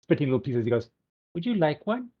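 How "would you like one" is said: mouthful of food, higher voice; cheeks stuffed full. Retold or imitated. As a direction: talk through a full mouth. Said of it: "with a mouthful of food, spitting little pieces".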